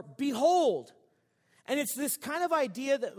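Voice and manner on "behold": called out, louder; a sudden ringing "Behold"; exclaimed behold. Retold or imitated. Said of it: "it actually says".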